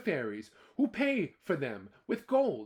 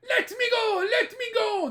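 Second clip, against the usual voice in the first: very high, loud squealing leprechaun; leprechaun shouting; 8 dB louder than usual. Acted. Caught leprechaun shouting to be let go, very high. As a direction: squeal to get free. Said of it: "the leprechaun shouted".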